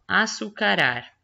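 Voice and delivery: açucarar spaced out as a-çu-ca-rar; syllable by syllable. Shown as a demonstration. Teacher checking a word's syllables, açucarar spelled out by syllable. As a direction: break açucarar into its syllables, ending on a separate rar. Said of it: "Vamos ver aqui".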